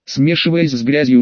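usual speaking voice

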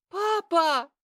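high, desperate cry for help; loud wail. A narrator's reading. Girl under attack calling for her father, screaming. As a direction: scream it out in panic. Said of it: "Этот громкий вопль".

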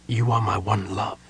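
breathy whispered confession; soft whisper. A narrator's reading. Sylvan whispers his love to Mina at parting, a soft whisper. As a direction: whisper it as he leaves her. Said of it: "he said softly".

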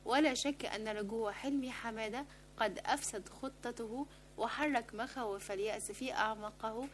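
usual speaking voice

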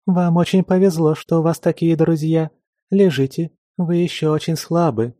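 high, bright chirpy maid voice; chirping. Acted. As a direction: chirp it like a cheerful little bird. Same chirpy stranger voice.

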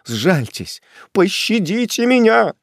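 desperate plea climbing high; begging for mercy. A narrator's reading. Prisoner at executioner's feet, desperate begging. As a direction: beg for your life. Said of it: "кинулся к ногам палача".

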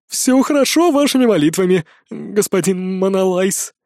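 plain reading voice

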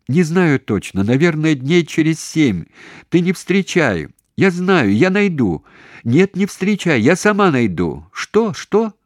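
high, loud, urgent phone talk; shouting. A narrator's reading. Daughter answers mother's questions by phone, shouting high. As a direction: call out over a failing line. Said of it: "всё ещё кричала".

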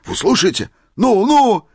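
very high, loud prodding; excited urging. A narrator's reading. Old man making sure all listen, excited urging. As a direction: egg him on breathlessly eager.

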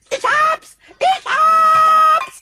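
high, loud victory cry; triumphant shout. Acted. Kobold crowing in triumph at his find. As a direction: yell it out, delighted. Pumuckl triumphantly finds the culprit.

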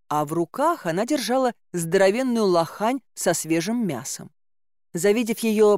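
ordinary delivery, nothing marked

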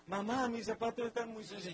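put-on child complaining to mom about dirty shoes, whiny. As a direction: play the little kid fussing about shoes. Child voiced complaining to mother.

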